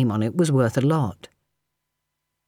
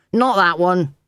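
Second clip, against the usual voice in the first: a sharp, louder call after her; 4 semitones higher, 3 dB louder than usual. A narrator's reading. James calls sharply after the maid going to the wrong door. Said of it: "James called sharply".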